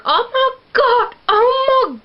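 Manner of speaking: hammed-up tearful bride; mock crying Bella. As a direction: ham up a weepy bride showing off her ring. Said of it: "And Bella's like".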